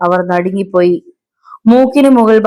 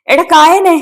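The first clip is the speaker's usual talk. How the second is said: very high, urgent cry; desperate scream; 9 semitones higher, 4 dB louder, slower than usual. A narrator's reading. Man screams to rouse his dead friend, desperate cry. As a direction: cry out desperately, pitch soaring. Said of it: "Vijayaraj അലറി വിളിച്ചു".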